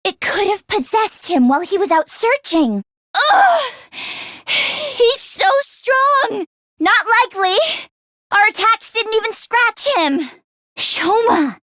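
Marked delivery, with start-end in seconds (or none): 3.2-6.4 pushing hard against him; straining effort. Acted. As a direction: grunt with strain, slow. Straining against a strong foe.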